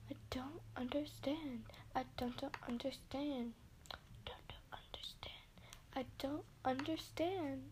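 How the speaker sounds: breathy whisper, over and over. Pretend. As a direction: whisper it in confusion. Repeating line in whisper.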